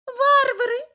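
very high and loud cooing, rising; cooing pigeon voice. Acted. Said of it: "закурлыкали голуби". (громкое курлыканье голубей)

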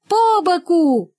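high, loud, drawn-out chant; loud high-pitched collective cry; class crying out. A narrator's reading. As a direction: shout it slowly like a whole class rejecting something. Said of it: "вскричал класс".

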